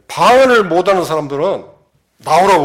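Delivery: loud, summoning call to the congregation; a pastor loudly summoning those without tongues. Retold or imitated. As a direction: call them forward from the pulpit. Pastor calling people forward.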